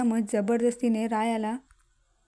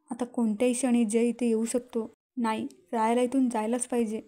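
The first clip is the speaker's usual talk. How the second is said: ordinary delivery, nothing marked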